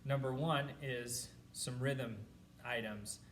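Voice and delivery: usual speaking voice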